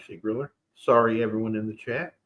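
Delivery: ordinary talk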